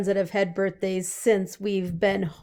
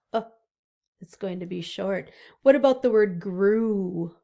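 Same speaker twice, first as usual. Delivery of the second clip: normal talking voice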